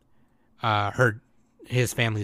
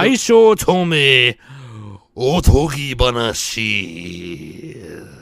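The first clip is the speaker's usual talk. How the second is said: very slow, louder cool title read; 5 dB louder, much slower than usual. Pretend. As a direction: stretch each word like an epic title. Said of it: "you gotta say the".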